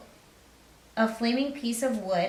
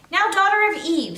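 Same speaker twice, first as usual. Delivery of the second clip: cheerful fawn; 10 semitones higher, 8 dB louder than usual. Acted. Fawn calling Lucy to tea.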